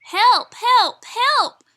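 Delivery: high, loud calls for help; crying for help. A narrator's reading. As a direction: call out in alarm. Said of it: "cried the Little Blue Truck".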